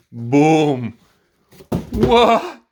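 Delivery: loud, high amazed yell; shocked shout. Real, not acted. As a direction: shout in disbelief. Unboxing the huge part.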